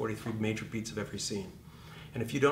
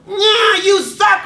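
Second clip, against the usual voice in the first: much higher, shouted sneer of the inner critic; mocking inner critic; 17 dB louder, much slower than usual. Coach mimics the actor's inner critic, a shrill jeering shout. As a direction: yell it like a heckler in your head.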